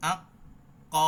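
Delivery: আ ক with the sound cut off hard; ক with a full stop of air; stopped qaf demo. Shown as a demonstration. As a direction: block the sound on ক. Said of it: "sound কিন্তু আটকায় দিতে হবে".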